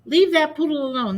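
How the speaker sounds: high, loud telling-off; scolding shout. Acted. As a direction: yell at the bully dog. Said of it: "unleashed a torrent of words".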